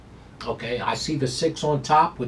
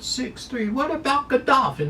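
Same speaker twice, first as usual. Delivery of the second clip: excited; 13 semitones higher than usual. Real, not acted. Hyped rhetorical call.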